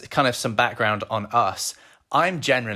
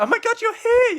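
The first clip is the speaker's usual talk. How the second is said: much higher, squealing excited voice; imitating excited girlfriend; 5 dB louder than usual. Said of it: "your, like, high voice when you're, like, really excited".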